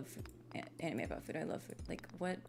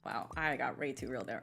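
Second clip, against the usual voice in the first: ordinary delivery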